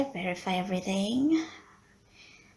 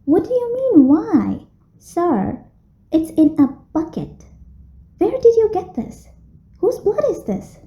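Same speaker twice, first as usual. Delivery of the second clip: high, loud, alarmed questions; shocked outburst; 9 semitones higher, 9 dB louder than usual. Acted. Receptionist aghast at Mr Helsing's bucket of blood, shocked and loud. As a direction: recoil in disbelief at the bucket.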